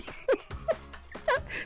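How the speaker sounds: breathless high-pitched laughing fit; can't stop laughing, air only; helpless high laugh. Real, not acted. (ضحك متواصل بلا صوت تقريبا)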